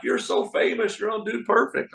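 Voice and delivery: high-pitched mock fan gushing; imitated excited kids. Magician mimicking kids who saw him on a show, excited. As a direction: gush it high like a young fan.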